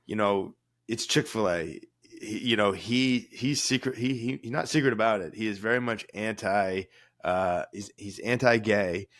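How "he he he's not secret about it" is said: ordinary delivery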